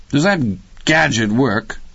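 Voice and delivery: high-pitched, pointed question; prying wife. A narrator's reading. Wife quizzing husband about his gadget, prying.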